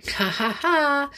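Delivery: hint of a gloating snowman laugh; mock laugh. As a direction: chuckle triumphantly at Amelia. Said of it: "he laughed when he saw".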